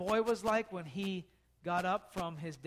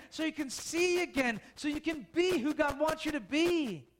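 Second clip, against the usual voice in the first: preaching; 4 semitones higher than usual. Acted. Preaching appeal.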